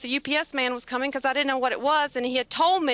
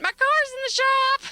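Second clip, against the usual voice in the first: sister's voice; 11 semitones higher, 3 dB louder than usual. Retold or imitated. Sister's reply quoted, high voice.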